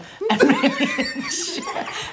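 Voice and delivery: burst of laughter. Real, not acted. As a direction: crack up laughing.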